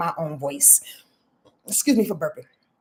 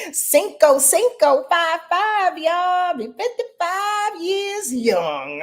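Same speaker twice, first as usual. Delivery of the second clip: excited; 8 semitones higher, slower than usual. Real, not acted. Excited about her birthday.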